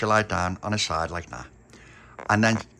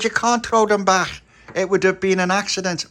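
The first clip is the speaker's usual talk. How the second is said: indignant telling-off; 9 semitones higher, 4 dB louder than usual. Acted. Tony scolding Stella.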